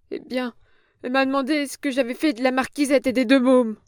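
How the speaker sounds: put-on a cringing old woman protesting; frightened old crone. A narrator's reading. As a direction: plead like a scared old hag.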